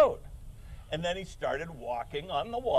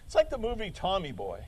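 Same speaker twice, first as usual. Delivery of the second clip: ordinary delivery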